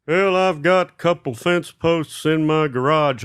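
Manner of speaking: neighbor impression. Neighbor offering spare fence posts, slow imitated voice. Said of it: "this guy, he goes".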